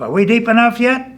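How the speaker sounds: high, loud call from down below; hollering up. Acted. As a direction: holler the question up out of a hole. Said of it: "the bullfrog hollers up".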